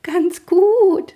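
very high, sugary countess coo. A narrator's reading. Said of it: "säuselte die Gräfin". (säuselnd, sehr hoch)

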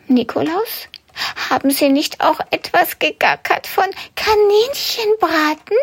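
high, anxious little rabbit voice; piecing it together with growing fear; worried rabbit. Acted. Rabbit puzzling in the sack. (hohe, ängstliche Kaninchenstimme)

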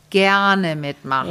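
"gerne mitmachen" said slowly, with weight on "gerne"; stress on gerne mitmachen. Shown as a demonstration. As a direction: lean on "gerne" and slow down through "mitmachen". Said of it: "die Betonung liegt auf".

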